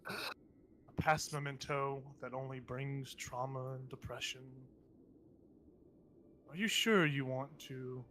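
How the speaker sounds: taunting inner voice. Acted. Voice in Ashana's head pitying her, slow taunting. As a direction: speak slowly, quietly, with false pity.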